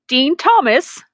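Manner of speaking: ordinary talk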